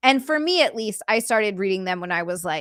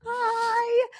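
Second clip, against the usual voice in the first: high squeaky hi; 14 semitones higher than usual. Pretend. High put-on greeting.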